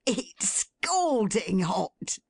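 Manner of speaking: put-on slow, relishing old man's echo; delighted Toby. A narrator's reading. Toby echoes his daughter, slowly relishing it. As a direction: savour the words happily. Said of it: "roared Toby".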